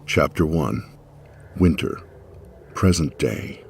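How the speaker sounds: usual speaking voice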